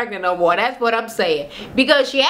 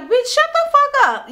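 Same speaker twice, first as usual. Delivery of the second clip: high mock snap at a castmate; imitating Minnie, high and sharp; mock Minnie snapping; 14 semitones higher than usual. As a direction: play Minnie telling her off, pitched way up. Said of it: "Minnie is like".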